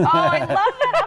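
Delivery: high, slow, laughing coo; laughing delight. Real, not acted. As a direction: gush over it with a laugh. Delighted reaction to nephew photo.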